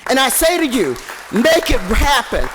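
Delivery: loud preaching at a council meeting; fiery preaching. Real, not acted. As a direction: preach it to the council, full force. Impassioned rally speech.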